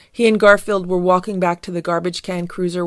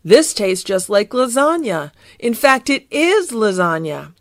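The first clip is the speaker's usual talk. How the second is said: louder, rising excitement; delighted discovery; 4 semitones higher, 5 dB louder than usual. Acted. Garfield licks the messy stuff, delighted discovery. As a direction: realise it with growing glee.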